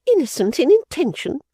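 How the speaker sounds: high, mocking repeat of "Innocent"; Fanny mocking the father's phrase; scornful echo. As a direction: throw her words back with scorn. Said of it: "the implacable Fanny struck in".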